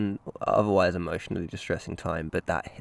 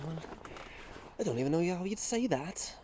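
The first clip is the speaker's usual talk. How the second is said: usual speaking voice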